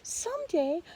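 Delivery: high earnest little-mouse promise; pleading mouse. Acted. Mouse promising to help the lion, high earnest voice. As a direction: promise sweetly, small and eager. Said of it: "begged the mouse".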